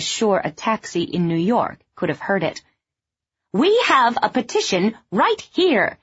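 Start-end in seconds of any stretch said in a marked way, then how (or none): 3.5-6.0 loud, higher bullhorn call; shouting into a bullhorn. A narrator's reading. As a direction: announce it through a megaphone. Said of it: "she shouted into a bullhorn".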